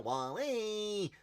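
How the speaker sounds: high-pitched little robot saying its name; Wall-E. Acted. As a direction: squeal it out, stretched like a cartoon robot. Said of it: "You know Wall-E from Pixar?".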